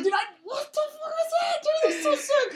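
very high, laughing, half-shouted awe; laughing amazement. Retold or imitated. Telling friends how amazed he was, high laughing shout. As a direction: cackle it out, amazed and grossed out. Retelling his amazed reaction.

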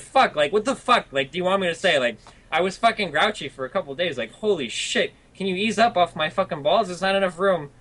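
high-pitched, worked-up venting; exasperated rant. Real, not acted. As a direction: blow up at people nagging you. Exasperated rant at nagging critics.

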